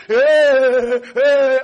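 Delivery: drawn-out, loud panicked wail; hammed-up panicking; mock panic. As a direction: put on a long flustered moan. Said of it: "then you start panicking".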